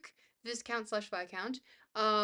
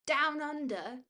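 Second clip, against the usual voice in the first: higher, questioning Aussie "Down under"; Australian accent; 4 semitones higher, slower than usual. Acted. Streamer tries a viewer's phrase, questioning Australian accent. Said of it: "accent is Bonzo, we say down under".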